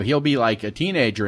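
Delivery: ordinary delivery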